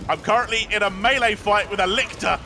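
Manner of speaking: high, urgent, pressed for help; urgent call for help. Real, not acted. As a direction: shout it out while under attack. Calling for help mid fight.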